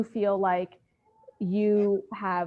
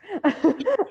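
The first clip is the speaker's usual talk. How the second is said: embarrassed laugh; 9 semitones higher, 6 dB louder than usual. Real, not acted. Laughing at her own silly question.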